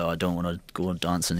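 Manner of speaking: half-hearted Yorkshire bloke impression; Yorkshire accent.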